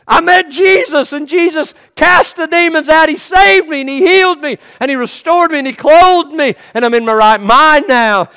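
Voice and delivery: joyful testimony. Acted. Healed man telling everyone of Jesus, jubilant and loud. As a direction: testify with overflowing joy.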